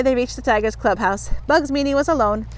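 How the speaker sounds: usual speaking voice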